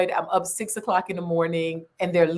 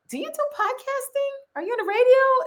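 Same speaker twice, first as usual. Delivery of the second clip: much higher, bright questioning voice; imitating eager stranger. Quoting a Clubhouse stranger in a high eager voice. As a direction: mimic the excited room host.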